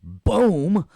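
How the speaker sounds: high, loud, drawn-out "boom"; cheesy homemade explosion sound; vocal boom effect. Pretend. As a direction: punch out a cartoon boom. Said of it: "it did not make the boom sound".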